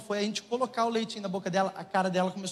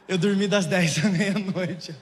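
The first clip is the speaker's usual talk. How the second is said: laughing; 3 semitones lower, 5 dB louder, slower than usual. Real, not acted. Laughing at his own story.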